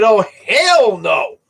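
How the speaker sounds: slow wary oh hell no; wary refusal. Retold or imitated. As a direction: stretch it out, suspicious. Alarmed by men with gloves.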